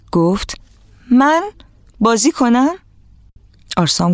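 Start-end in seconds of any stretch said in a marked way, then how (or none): 1.1-2.7 high, halting, incredulous question; disbelieving. A narrator's reading. Atrin thinks he misheard the invitation, disbelieving. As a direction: ask it haltingly, pitch lifted in surprise.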